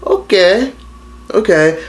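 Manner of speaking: high, fake-tearful okay; mock hurt mom. Mom acting hurt as her daughter hangs up, mock tearful and high. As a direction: ham up a wounded mother. Mother voiced in retold call.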